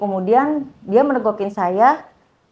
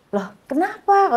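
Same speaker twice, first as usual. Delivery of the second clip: higher-pitched, surprised "huh, why?" reaction; retold startled question; 9 semitones higher than usual. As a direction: imitate someone taken aback. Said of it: "Dia kaget". (menirukan orang kaget, nada naik)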